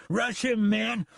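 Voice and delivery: high shouted command; rally the mob to charge; shouting. Acted. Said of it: "he shouted".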